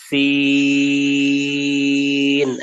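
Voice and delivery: Arabic letter সিন held out long, chanted; long drawn sin. Shown as a demonstration. Teacher demonstrating a long held সিন. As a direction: stretch সিন for a full three counts. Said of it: "টান হবে তিন second".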